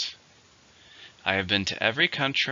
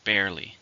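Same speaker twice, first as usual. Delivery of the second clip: barely with the first syllable "bare-" stressed; teacher models barely, weight on "bare-". Shown as a demonstration. Model the word for students, stress on "bare-". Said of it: "stresses on the first".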